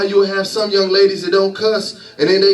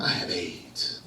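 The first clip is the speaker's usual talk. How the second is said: I have aids breathed out, much lower and softer; whispered confession; 14 semitones lower, 13 dB quieter, slower than usual. Retold or imitated. Friend confessing his illness to his buddies, whispered. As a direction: barely get the words out.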